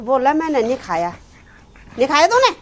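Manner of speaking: loud, high cross question; scolding. Real, not acted. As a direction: snap the question at the dog. Scolding the dog.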